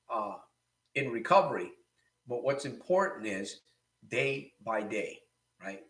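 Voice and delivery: ordinary talk, nothing marked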